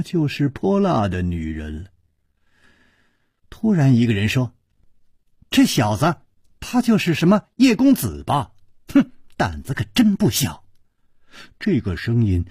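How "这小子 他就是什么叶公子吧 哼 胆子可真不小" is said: usual speaking voice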